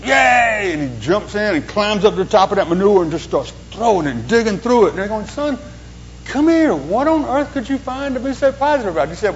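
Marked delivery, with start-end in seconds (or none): 0.0-0.7 put-on high, loud kid's cheer; gleeful yay. Optimist boy at the manure pile, loud gleeful cheer. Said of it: "he goes". 5.3-9.1 high, incredulous call to the son; puzzled parent calling. Retold or imitated. Parents to the boy in the manure, high and incredulous.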